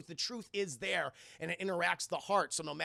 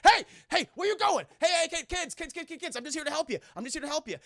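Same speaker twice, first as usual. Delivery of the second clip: high, loud yelled hey, hey; mock shouting sheriff; 10 semitones higher, 9 dB louder, faster than usual. Sheriff shouting after the fleeing kids. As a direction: call after them, hammed-up. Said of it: "And Nick Good's like".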